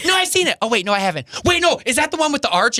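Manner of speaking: high, flustered flip-flopping; unsure of yourself, voice jumping up; dithering squeak. Retold or imitated. To a friend, doubting whether you saw a movie, flustered high voice.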